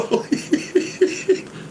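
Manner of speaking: high wheezy giggle; tickled, high-pitched giggling; helpless little high laugh. Real, not acted.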